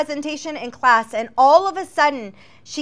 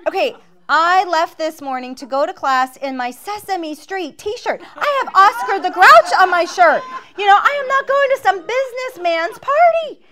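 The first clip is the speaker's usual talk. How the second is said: indignant comic protest; 7 semitones higher, 7 dB louder than usual. Retold or imitated. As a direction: voice climbing very high and loud. Retold comic protest about the party.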